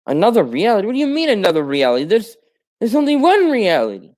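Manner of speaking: flustered, fast and high-pitched; incredulous protest. Retold or imitated. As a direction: argue that there can only be one reality. Hero rejects another reality.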